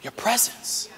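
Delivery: whispered. Shown as a demonstration. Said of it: "It's simply".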